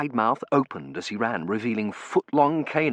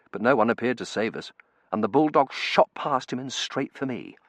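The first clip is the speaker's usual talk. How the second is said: usual speaking voice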